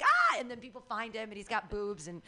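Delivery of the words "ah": very high laughing mock shriek of alarm. Retelling Ranma finding himself a girl, high mock shriek. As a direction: shriek like a startled cartoon boy. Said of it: "he's like".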